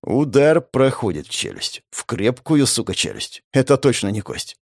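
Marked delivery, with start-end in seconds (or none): none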